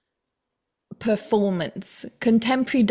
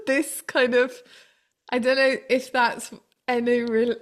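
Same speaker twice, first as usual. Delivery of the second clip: ordinary talk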